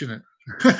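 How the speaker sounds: normal talking voice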